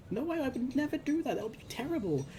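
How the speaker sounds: high-pitched protest of innocence; mock-distressed denial; acted pleading denial. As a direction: imitate someone falsely accused, pleading in a high voice. Accused character acts out denial.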